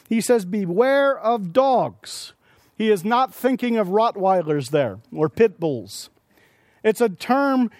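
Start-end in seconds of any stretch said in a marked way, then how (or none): 0.4-2.3 each word drawn out slowly and weighty; slow it right down and weigh every word; slow solemn warning. Acted.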